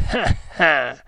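short satanic chuckle; sneering laugh. A narrator's reading. Manciadi alone after fooling them, sneering laugh. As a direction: let out a sly, sneering "ha ha". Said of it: "esclamò sogghignando".

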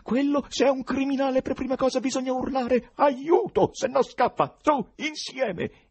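high, loud rallying cries; highly animated. A narrator's reading. Choirmaster urges Ivan to yell for help, highly animated. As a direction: whip him up to shout for help together. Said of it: "si animò al massimo".

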